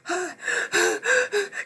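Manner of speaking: high, hammed-up gasp of fright; a big dramatic gasp mocking Triss's fear; melodramatic gasp. Reviewer mocking Triss on the Ferris wheel, hammed-up gasp. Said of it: "melodramatic with her being like".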